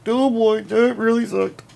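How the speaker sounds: strained gasping words on the in-breath; talking while inhaling. Shown as a demonstration. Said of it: "You can only speak while inhaling".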